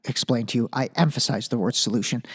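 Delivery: lightly voiced, hurried impatient lecturer; mocking Nancy Pelosi. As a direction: pitch it down and rattle it off like an impatient senator lecturing. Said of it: "She's literally like".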